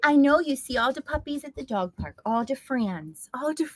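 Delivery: lightly voiced dog talking to mom; a hint of a pleading puppy voice; dog's voice. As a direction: give the dog a sweet voice. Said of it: "He's like, "Mom, excuse me".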